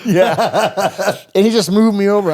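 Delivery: loud, high laughing yeah. Real, not acted. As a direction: crack up while agreeing. Laughing at bro's joke.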